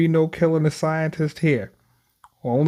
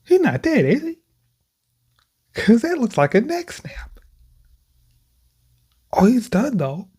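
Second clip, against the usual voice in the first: shocked disbelief; 10 semitones higher than usual. Real, not acted. Gamer shocked by neck snap.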